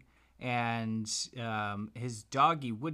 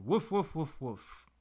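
dog barking; 4 semitones higher than usual. Acted. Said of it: "and he said".

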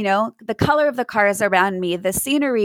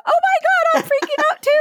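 much higher, louder mock panic; panicked yelling; 3 dB louder than usual. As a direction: freak out right along with the caller. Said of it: "started yelling at you".

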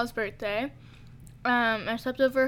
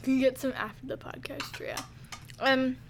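chewing while talking; 5 dB quieter than usual. Real, not acted. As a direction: mouth full, words round food. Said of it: "It's really hard to talk while eating".

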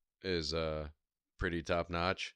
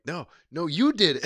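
high, loud accusing ref voice; mock referee; 11 semitones higher, 12 dB louder than usual. Referee blaming the opponent, hammed-up high accusation. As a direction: wag a finger at the wrong man. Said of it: "the ref was like".

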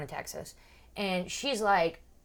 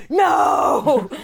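loud, high, long shout; drawn-out mock crowd roar; crowd shouting back; 8 semitones higher, 13 dB louder than usual. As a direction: boo it back like a whole audience.

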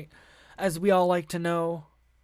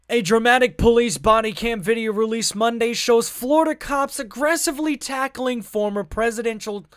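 louder newscaster voice; 4 semitones higher, 6 dB louder than usual. Shown as a demonstration. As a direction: read it like a TV anchor. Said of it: "read this in my newscaster voice".

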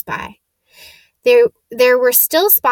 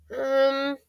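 higher-pitched, hesitant stammer; timid; 4 semitones higher than usual. A narrator's reading. Hermione answers the centaur's question about school, timidly. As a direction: answer shyly, stumbling into it. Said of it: "said Hermione timidly".

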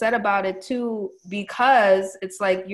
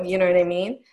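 usual speaking voice

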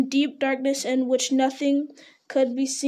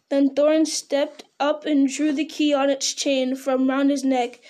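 plain reading voice

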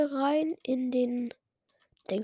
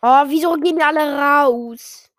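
loud, high oh why are they leaving; surprised outcry; 4 semitones higher, 13 dB louder than usual. Real, not acted. Gamer watching players quit the lobby, loud surprised outcry. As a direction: call out in disbelief.

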